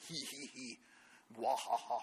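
soft sneaky villain snicker; mild mock evil laugh. Shown as a demonstration. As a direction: chuckle like a lightly voiced villain. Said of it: "Or if it's a villain".

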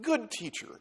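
polite address. Pretend. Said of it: "You say".

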